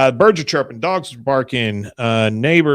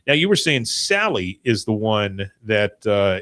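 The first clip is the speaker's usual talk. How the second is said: ordinary delivery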